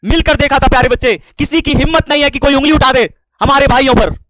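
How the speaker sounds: loud, high, fervent outburst; heated, forceful cry of brotherhood; impassioned shouting. Real, not acted. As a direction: declare loyalty at full voice. Impassioned outburst defending his brother.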